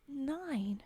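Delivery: Nine? as a shocked father-hearing query; surprised question. Acted. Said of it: "language barrier".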